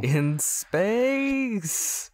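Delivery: hammed-up trailer tease; mock movie tagline. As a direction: stretch it out like a silly sequel title. Mock sequel tagline, drawn out.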